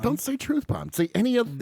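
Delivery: high, mock-pleading scolding; put-on high-pitched exasperation; exasperated self-reproach. Berating himself in an imagined skit.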